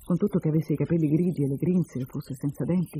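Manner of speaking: plain reading voice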